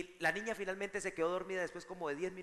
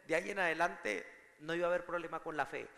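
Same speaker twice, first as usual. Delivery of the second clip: ordinary talk, nothing marked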